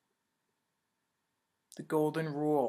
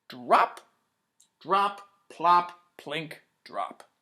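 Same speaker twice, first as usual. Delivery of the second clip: drop sounds; 4 dB louder, much slower than usual. Acted. Drops landing in bucket.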